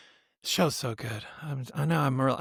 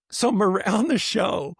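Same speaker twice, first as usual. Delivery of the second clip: ordinary talk, nothing marked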